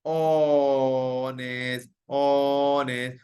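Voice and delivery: Honest held long and slow on the O; slow, sung honest for the class; stretched word. Shown as a demonstration. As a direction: draw out honest so the O sound rings. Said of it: "sound क्या आ रहा है?".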